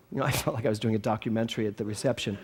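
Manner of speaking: ordinary delivery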